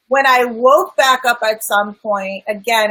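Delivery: usual speaking voice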